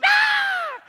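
high loud shrieking ah; a child's sudden delighted shriek; imitated scream. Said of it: "She went".

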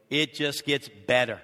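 normal talking voice

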